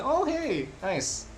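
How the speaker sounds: ordinary talk, nothing marked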